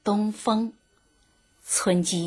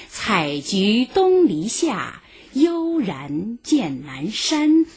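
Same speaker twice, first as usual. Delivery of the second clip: slow poem quote; 3 semitones higher, slower than usual. Shown as a demonstration. Quotes Tao Qian poem.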